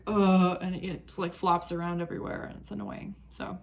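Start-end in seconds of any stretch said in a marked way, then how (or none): 0.1-0.6 exasperated groan. Retold or imitated. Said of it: "it's just like".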